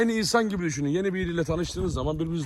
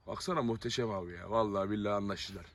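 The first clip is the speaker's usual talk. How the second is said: low, hushed, fond admiration; quiet marvelling; 9 semitones lower, 10 dB quieter than usual. Real, not acted. Owner watching the dogs finally get along, softly marvelling. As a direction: marvel softly, almost to yourself.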